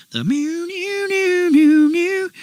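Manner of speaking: high sung guitar lick; singing a riff. Retold or imitated. Imitating guitar riff melody.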